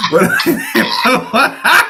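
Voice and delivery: real laughter breaking through words; laughing. Real, not acted. Telling the apology scene, laughing through his words. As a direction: laugh while trying to talk.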